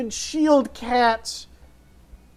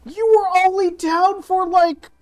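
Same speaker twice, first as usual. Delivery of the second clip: alarmed; 7 semitones higher, 5 dB louder than usual. Acted. Alarmed at grown armor.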